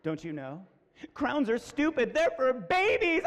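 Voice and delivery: slow taunt, climbing in pitch; condescending lead girl. Retold or imitated. Lead girl belittling the daughter, condescending. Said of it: "lead girl walking right up to my daughter".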